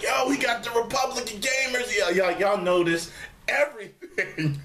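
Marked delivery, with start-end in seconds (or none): 0.0-1.9 excited viewer. Retold or imitated. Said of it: "They're like".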